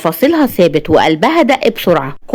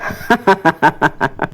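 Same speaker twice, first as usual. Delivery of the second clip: low, short chuckles; acted low ha-ha chuckle; man laughing; 3 semitones lower, 4 dB quieter than usual. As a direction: laugh along in a low male voice. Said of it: "ضحك هو التاني".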